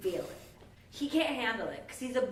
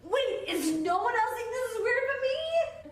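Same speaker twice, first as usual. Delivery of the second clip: high, loud incredulous voice; baffled Elphaba; 13 semitones higher, 5 dB louder than usual. Retold or imitated. Elphaba protests in class, high and incredulous. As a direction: disbelief that nobody else sees it. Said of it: "Elf was like".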